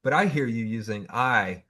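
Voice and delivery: ordinary talk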